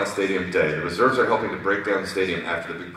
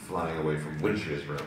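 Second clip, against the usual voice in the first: usual speaking voice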